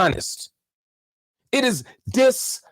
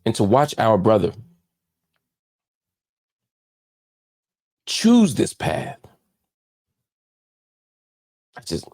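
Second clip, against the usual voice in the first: much lower, subdued heartbroken voice; disappointed; 12 semitones lower than usual. Real, not acted. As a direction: let the voice sink with real hurt over a friend. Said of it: "I'm so disturbed and, and, and disappointed".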